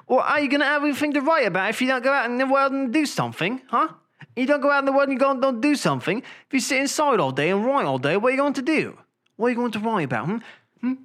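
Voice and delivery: put-on high, rapid nagging British accent; hammed-up British nagging. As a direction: scold in a mock British voice. Imitates wife's British nagging.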